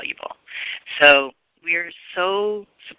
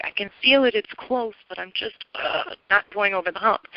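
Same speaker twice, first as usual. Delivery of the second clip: higher-pitched, exasperated venting; frustrated; 5 semitones higher than usual. Retold or imitated. Callers venting to the astrologer, mildly frustrated. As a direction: imitate frustrated callers who feel stuck. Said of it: "people that are really frustrated".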